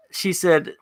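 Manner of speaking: normal talking voice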